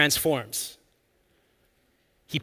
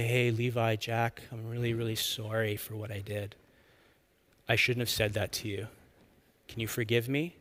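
soft, lower, heartfelt apology; sincere apology; 3 semitones lower, 7 dB quieter than usual. Shown as a demonstration. Parent coaches kids how to ask forgiveness, sincerely. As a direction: model a gentle, contrite sorry. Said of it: "I'm sorry and I'm grieved".

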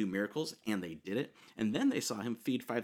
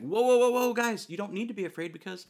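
much higher, louder quick reassurance; hasty calming; 10 semitones higher, 6 dB louder than usual. Retold or imitated. Jesus hurrying to calm the frightened disciples, urgent. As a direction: rush in to calm them down.

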